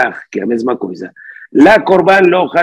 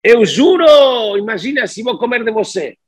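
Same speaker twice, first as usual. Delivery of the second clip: acted oath, high-pitched and incredulous; dismissive mock oath; 6 semitones higher than usual. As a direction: swear it as if the idea were unthinkable. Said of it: "como si fuese, dice así".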